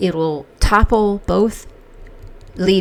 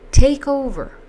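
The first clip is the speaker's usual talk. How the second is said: incredulous, higher echo of take over; mocking echo; 7 semitones higher, 4 dB louder than usual. Echoing his claim in mock disbelief. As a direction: scoff at the words take over.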